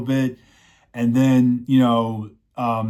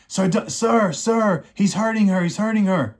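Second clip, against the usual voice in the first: put-on high, urgent boy's cry for help; frantic young boy; 8 semitones higher than usual. Boy begging the knight to save the girl, high and frantic. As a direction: squeak it out like a scared kid pleading.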